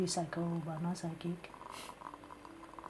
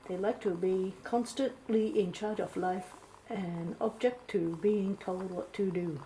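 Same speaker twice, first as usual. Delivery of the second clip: normal talking voice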